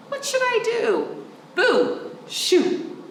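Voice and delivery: shooing. Acted. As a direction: chase the moose off. Child shooing moose.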